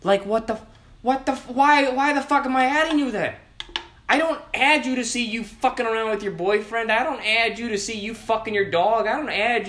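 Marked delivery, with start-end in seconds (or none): 0.0-5.9 much higher, exasperated rant; ranting outrage. Real, not acted. Complaining to viewers about silent Snapchat adds, exasperated. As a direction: vent in baffled outrage. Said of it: "a little bit of a rant session".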